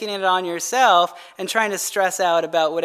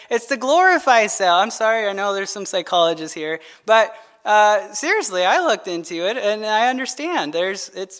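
ordinary delivery

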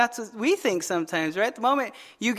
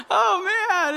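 high laughing cry; 9 semitones higher than usual. Real, not acted. Preacher cracking up at his own story, laughing high.

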